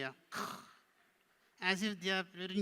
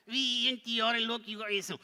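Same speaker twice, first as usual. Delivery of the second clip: higher, louder puppet spelling; parroting puppet; 8 semitones higher, 7 dB louder than usual. Pretend.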